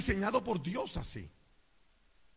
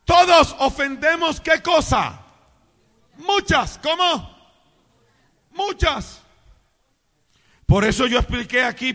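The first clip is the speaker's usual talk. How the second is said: loud, high pulpit exhortation; booming call-and-response preacher; full-force preaching; 6 semitones higher, 9 dB louder than usual. Acted. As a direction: rouse the congregation to answer back. Preaches, drawing responses from congregation.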